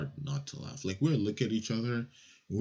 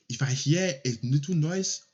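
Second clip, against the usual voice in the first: lightly voiced menacing official; a hint of an angry school official's threat; threatening superintendent; 4 semitones higher, 4 dB louder than usual. Superintendent threatening the children, lightly menacing voice. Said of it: "exactly what he said".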